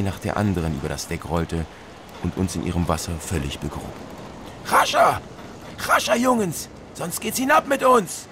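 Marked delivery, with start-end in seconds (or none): 4.7-8.3 shrill, very high panicked yell at the crew; captain Turnerstick screaming at his sailors to hurry; screamed urging. Acted. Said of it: "schrie Turnerstick".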